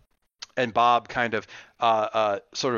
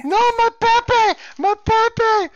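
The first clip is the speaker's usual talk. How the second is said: very high, loud hammed-up wail; panicking Dominican grandmother; 8 dB louder, much slower than usual. Grandmother fighting her rescuer to reach Pepe, panicked shriek. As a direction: shriek frantically in a high granny voice. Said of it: "she's freaking out".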